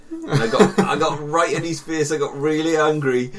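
laughing through the words. Real, not acted. Recounting his own rage to a friend, laughing. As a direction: laugh as you tell it.

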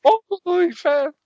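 mock preacher curse.

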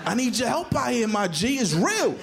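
high, worked-up appeal; urgent pleading kid. Retold or imitated. Boy begs his dad for help, high and urgent. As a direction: beg for backup like an overdramatic little boy.

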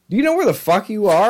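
lightly voiced gang member, disbelieving; incredulous gang guy. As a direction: quote the local guy warning him off. Retold gang member confronting him.

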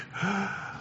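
a quick high gasp for air; sharp gasp. Shown as a demonstration. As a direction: suck in air like you finally surface. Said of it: "somebody giving you breath".

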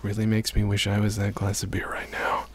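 breathy mock-sultry whisper. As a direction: whisper it close and teasing. Whispered joke in commentary.

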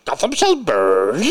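slow, drawn-out, put-on villain line; Bane voice. Podcaster showing co-host the film villain's voice, hammed-up Bane impression. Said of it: "what was his voice like?".

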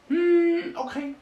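very high little gnome voice; reluctant gnome. Acted. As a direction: give in with a long squeaky hum. Gnome gives in, squeaky voice.

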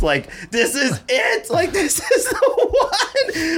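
very high, slow, laughing squeal of joy; giddy excitement. Retold or imitated. Man believing he won the Dior sneakers, high giddy laughter. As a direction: let the thrill break into a laugh.

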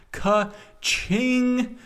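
high-pitched, celebratory ka-ching; playful high exclamation; gleeful cash-register call. Pretend. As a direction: sing out like a till ringing. Celebrating plugin sales like cash register.

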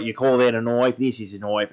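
Australian accent. Shown as a demonstration. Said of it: "that's probably about as much Australian".

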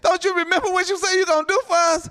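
high, insistent challenge to God; impassioned reminder of a promise; Moses pleading. Acted. Moses's plea resumed.

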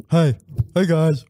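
slow dopey goofball voice; doofy buck-toothed voice. Retold or imitated. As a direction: play a doofy guy with buck teeth. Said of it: "literally looks like this".

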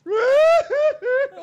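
high, loud imitation wail; mock wail. Acting out a friend crying at the clinic, mock wailing.

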